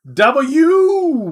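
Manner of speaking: a high, loud cheer for W; excited shout. Real, not acted. Streamer cheering a viewer's sub, excited shout.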